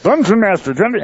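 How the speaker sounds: a hint of a frog puppet voice; Kermit the Frog voice. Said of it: "I can't do Kermit the Frog".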